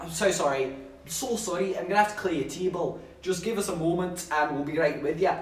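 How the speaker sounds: posh Scottish waitress apologising; imitated posh Scottish accent. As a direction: put on a genteel Scottish lilt. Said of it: "I'll have to do the posh Scottish voice again".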